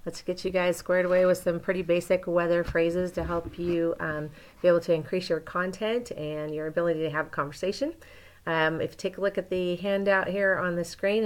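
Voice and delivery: usual speaking voice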